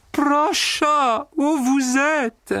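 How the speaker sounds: very high, tender, slow calling; tender musical voice. A narrator's reading. Secretary tenderly calling to the empty suit, very high. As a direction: coo his name sweetly after crying. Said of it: "d'une tendre voix musicale, mais le nez un peu bouché".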